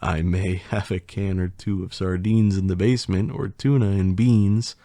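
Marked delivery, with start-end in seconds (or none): none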